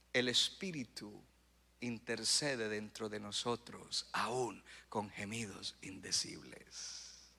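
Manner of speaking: very slow, low and soft; hushed reverence. Acted. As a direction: let it sink slowly into a solemn hush. Solemn scripture recitation, hushed.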